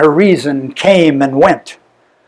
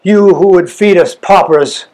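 plain reading voice

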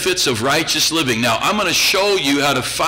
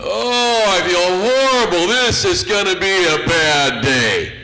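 mock morning moan; 3 semitones higher than usual. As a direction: ham up a grumpy waking complainer. Imitates waking up complaining.

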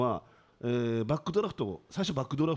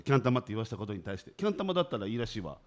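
ordinary delivery

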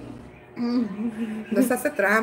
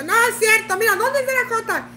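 much higher indignant mock denial; husband denying; 8 semitones higher than usual. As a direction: protest innocence in a put-on voice. Husband denies cheating in quarrel. (esposo que niega la trampa con voz mucho más aguda)

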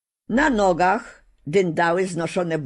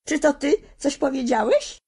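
wyżej, zaskoczona dziewczynka dopytuje; zdumiona, że kukła mówi; zdziwione pytanie; 9 semitones higher than usual. Acted. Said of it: "zapytała zdziwiona dziewczynka". (zdziwiona Dorotka pyta stracha na wróble)

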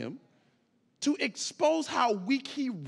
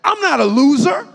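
louder, chest-out I'm not a loser; chest puffed out; 6 semitones higher, 18 dB louder than usual. Shown as a demonstration. Preacher showing how to say it with chest puffed, proud and loud. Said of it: "puff out your chest when you say that".

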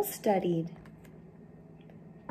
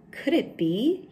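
wondering; 6 semitones higher than usual. Acted. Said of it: "he wondered".

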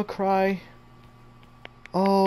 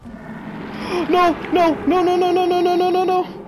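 real terrified screams of no, much higher and louder; screaming no over and over in real panic; panicked screaming; 11 semitones higher, 7 dB louder than usual. Real, not acted. Gamer chased by the game monster, terrified screaming.

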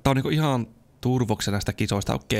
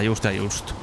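ordinary delivery

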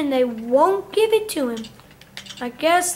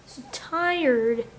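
ordinary talk

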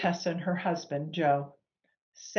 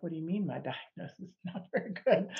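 incredulous laugh; 7 dB quieter, much faster than usual. Retold or imitated. Retelling her disbelief at the diagnosis.

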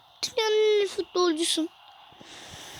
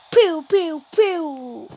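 mock blaster noise; 4 dB louder, much slower than usual. Real, not acted. Imitated shooting noise.

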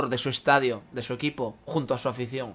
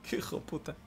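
laughing under breath; 2 semitones higher, 15 dB quieter than usual. Real, not acted. Se ríe del comentario del chat.

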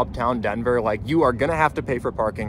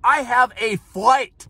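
retold impatience; 11 semitones higher, much slower than usual. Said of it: "I looked at him and said".

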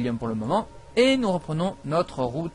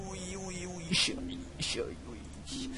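very soft, breathless oui-oui; hushed, slowing pillow talk; mock-amorous murmur; 2 semitones higher, 14 dB quieter, slower than usual. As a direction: murmur it like a hammed-up lover. Mock sexual talk, then calms down.

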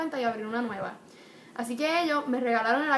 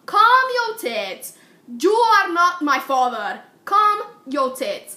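pissed-off telling off; 8 dB louder, much slower than usual. Retold or imitated. Talking back when Joel raises his voice, yelling. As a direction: yell it slowly, drawing out every word. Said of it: "I get really pissed off".

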